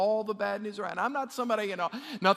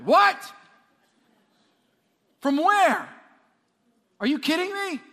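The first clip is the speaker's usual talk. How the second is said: higher, louder, what?! from where?!; shocked outburst; 7 semitones higher, 6 dB louder than usual. Acted. Pastor acting out hearing bad news at work, shocked shout. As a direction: blurt it out in disbelief.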